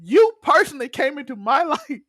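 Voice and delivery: high-pitched, drawn-out mock outrage; retold indignant accusation. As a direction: act out the aggrieved accusation, half laughing.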